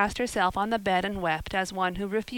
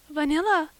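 put-on high, louder mortified girl; 8 semitones higher, 5 dB louder than usual. A narrator's reading. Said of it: "her face scarlet with mortification".

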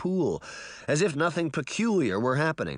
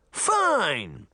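raised, high, falling cry; shouting back; 6 semitones higher than usual. A narrator's reading. Girl ending quarrel with boy, shouting back. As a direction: fling it back as a contained shout. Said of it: "Sabrina shouted back".

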